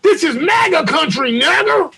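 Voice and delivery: high-pitched mock threat; high put-on attacker voice; mimicked attacker. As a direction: call it out high as the staged attacker. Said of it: "put a string around his throat and say".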